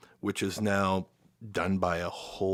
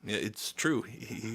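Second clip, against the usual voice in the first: normal talking voice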